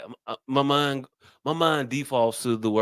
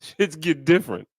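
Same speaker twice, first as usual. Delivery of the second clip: laughing drawl; 4 semitones higher, 4 dB louder, much slower than usual. Real, not acted. Laughing punchline about cheating.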